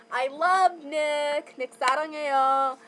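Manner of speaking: lightly cutesy Korean aegyo. Pretend. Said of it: "she wants me to do aegyo".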